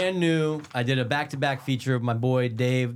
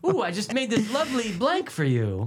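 high sing-song hostess voice; mock sweet cook; 9 semitones higher than usual. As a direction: offer the dish in a high, sugary put-on voice. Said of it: "try some of my".

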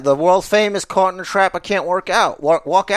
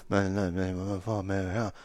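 low muttering; 8 semitones lower, 13 dB quieter than usual. Retold or imitated. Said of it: "And then I go".

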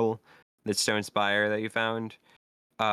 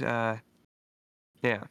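ordinary talk

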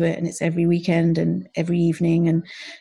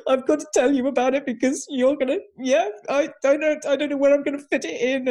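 much higher, tense, rushed admission; anxious confession; 8 semitones higher than usual. Retold or imitated. Wife admitting the three thousand dollar course, anxious and high. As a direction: spill it out nervously, expecting trouble. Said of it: "I said".

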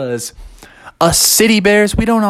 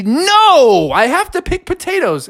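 high refusal; 12 semitones higher than usual. Acted. Bear refuses horse.

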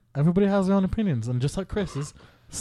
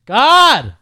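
loud, high burst of frustration; a sudden fed-up yell; exasperated shout; 9 semitones higher, 13 dB louder than usual. Real, not acted. Frustrated at friend's mic.

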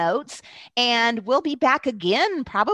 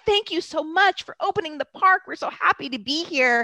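higher-pitched imitation of grateful hikers; retold joyful gratitude; 6 semitones higher than usual. Hikers thanking the park ranger, retold with joyful gratitude. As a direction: gush thanks as the happy crowd did. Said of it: "the joy, everybody was hiking".

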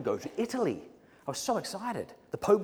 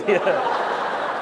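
ordinary delivery

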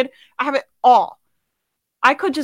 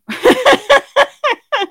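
bursts into a high, loud laugh; real laughter breaking out, high; 13 semitones higher, 6 dB louder than usual. Real, not acted. Speaker laughing at her own cheeky line to the audience.

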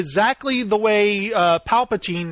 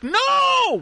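high, shouted cry of loss; Luke's anguished no. Retold or imitated. Recalling Luke's scream when Ben is killed. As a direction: wail it like Luke seeing Ben die. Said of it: "Luke when he's like".

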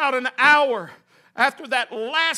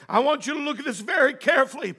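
ordinary delivery

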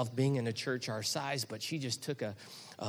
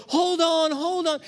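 loud, high urgent encouragement; dad yelling to son on coaster; urgent calling; 6 dB louder, slower than usual. Retold or imitated. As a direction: shout it over the ride. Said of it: "I'm going".

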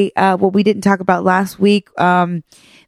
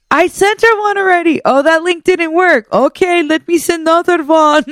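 put-on high-pitched, fussing older Persian relatives; imitating flustered relatives; 9 semitones higher than usual. Persian relatives scrambling to fix the Zoom link, imitated high fussing. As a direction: voice a family scrambling over tech, pitched up. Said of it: "hear them all scrambling and having input on".

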